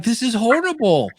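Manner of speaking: high-pitched appalled verdict; mock dismay. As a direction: play up being horrified. Mock reaction to bad podcasts.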